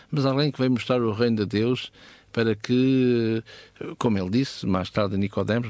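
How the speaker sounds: usual speaking voice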